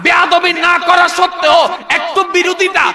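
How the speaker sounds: high-pitched, sustained impassioned preaching; full-force preaching. Real, not acted. Preacher reveals the Prophet's form to the crowd, full-force preaching. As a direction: drive the sermon to a shouted climax.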